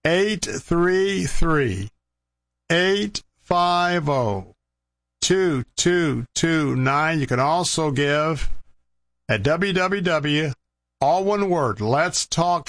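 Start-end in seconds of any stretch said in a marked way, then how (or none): 0.0-7.2 slow, spaced-out phone number; said slowly. Shown as a demonstration. Radio host repeating the number for callers to note, slowly. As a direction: draw out each digit, pausing between the groups. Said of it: "I'll say it slowly".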